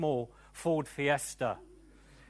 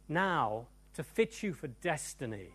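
ordinary delivery

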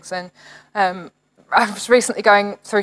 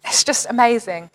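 normal talking voice

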